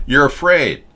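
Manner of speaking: ordinary delivery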